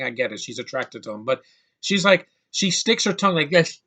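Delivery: usual speaking voice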